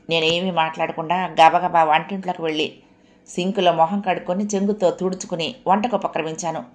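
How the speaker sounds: ordinary delivery, nothing marked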